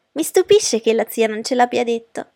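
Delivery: high, loud astonishment; exclaiming. A narrator's reading. Daughter amazed her aunt said nothing, exclaiming. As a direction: blurt it out like an excited girl. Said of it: "esclamò Lydia".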